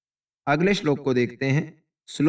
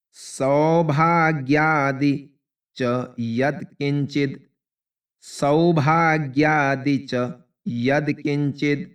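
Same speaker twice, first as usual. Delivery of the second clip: slow word reading; much slower than usual. Shown as a demonstration. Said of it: "इसमें कठिन".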